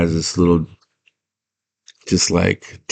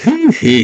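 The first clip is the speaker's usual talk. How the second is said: high mock "hee hee" cackle; goblin laugh. Hoggle laughing at the girl, high mock cackle. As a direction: imitate a little goblin's snicker. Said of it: "And he laughs like".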